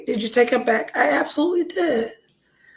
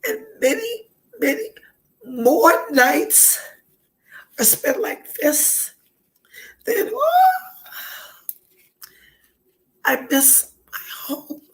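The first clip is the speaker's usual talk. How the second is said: broken words between long tearful pauses; really crying, struggling to get it out; homesick crying; 4 semitones higher, 3 dB quieter, slower than usual. Real, not acted. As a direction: pitch jumping, then collapsing into whisper. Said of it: "I'm homesick".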